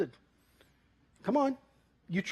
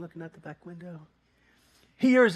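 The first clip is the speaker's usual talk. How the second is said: ordinary talk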